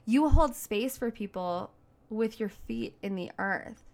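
normal talking voice

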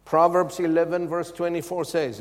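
ordinary delivery

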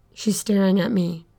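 ordinary delivery

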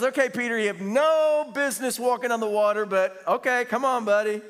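comic exasperated go-ahead; mock reluctant consent. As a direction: humour a foolish friend, loudly. Jesus reluctantly humours Peter.

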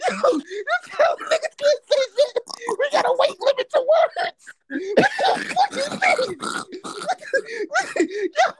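talking through helpless laughter; laughing rant. Real, not acted. Friend keeps ranting through his laughter. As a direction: rant while cracking up.

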